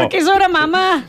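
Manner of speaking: high put-on child voice; a five-year-old's innocent question; little daughter. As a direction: play a puzzled little girl. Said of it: "Lola tiene cinco años. Es nuestra hija".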